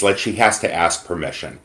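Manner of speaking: ordinary delivery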